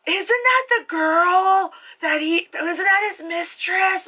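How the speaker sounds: very high, drawn-out questioning voice; mock clueless moviegoer. Imitating confused audience members figuring out the plot, mock clueless. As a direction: stretch it out in a squeaky mock puzzlement. Imitates puzzled people sitting nearby.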